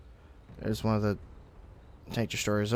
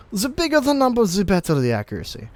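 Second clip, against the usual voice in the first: put-on a failed stab at a Russian accent, pitch jumping higher on the slogan; badly faked Russian accent, half-laughing; mock Russian accent; 7 semitones higher than usual. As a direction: ham up a bad Russian accent for the joke. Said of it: "I can't do a Russian accent".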